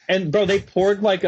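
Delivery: usual speaking voice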